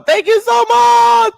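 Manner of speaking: excited shout. Real, not acted. Thanking a donor loudly.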